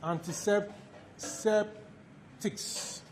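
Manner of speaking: antiseptics stretched very slowly and softly; drawn out. Shown as a demonstration. Lecturer dictating a term so students catch every syllable, very slow. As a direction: draw out each syllable of antiseptics.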